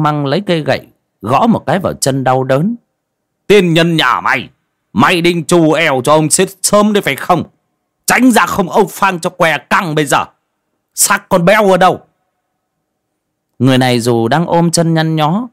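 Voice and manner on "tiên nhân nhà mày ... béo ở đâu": higher, louder cursing and threatening; a cranky elder telling him to get out of the way; angry old man. A narrator's reading. (ông già Măng chửi, giận dữ)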